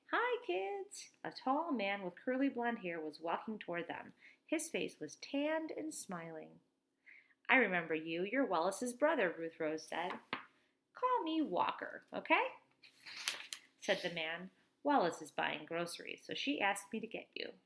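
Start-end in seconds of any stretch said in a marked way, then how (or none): none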